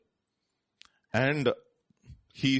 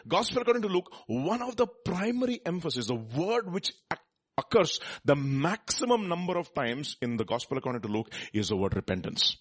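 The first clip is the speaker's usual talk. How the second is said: ordinary talk, nothing marked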